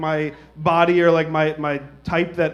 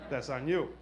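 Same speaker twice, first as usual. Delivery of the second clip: much softer throwaway repeat; muttered aside; 3 semitones lower, 10 dB quieter than usual. Shown as a demonstration. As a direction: drop it under your breath.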